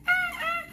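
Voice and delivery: high yelping ow ow, loud; sharp high cry of pain. Retold or imitated. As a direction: yelp in pain at a stuck contact. Contact stuck, pained cry.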